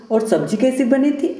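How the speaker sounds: scornful parroting of the wife's words; mocking echo. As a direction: throw her own question back at her, mocking. Husband mockingly echoes wife's question.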